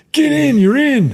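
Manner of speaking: high, loud sideline command; coach shouting an order. Retold or imitated. Coach sending the freshman into the game, loud shouted order. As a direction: bark him onto the field.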